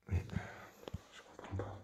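soft confused whisper; voiceless murmur. Real, not acted. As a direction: breathe it out in confusion. Hushed in a dark house.

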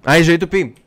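the penny drops out loud; loud aha recognition; sudden realization. Real, not acted. Realizing what the title means.

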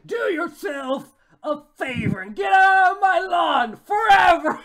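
loud, high melodramatic yell; hammed-up shouting. Judy ordering Nick off her lawn, mock-furious shouting.

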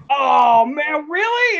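high, loud acted disbelief; mock amazed exclamation; delighted surprise. Retold actor's delighted reaction.

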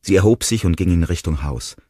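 ordinary delivery

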